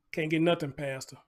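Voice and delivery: ordinary delivery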